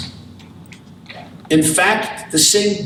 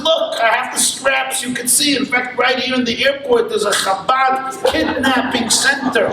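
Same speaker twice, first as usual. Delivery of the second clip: higher, lively, proud of the straps; excited boasting; 8 semitones higher than usual. Acted. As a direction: sell him the airport's wonders with glee. Israeli boasts about airport Chabad.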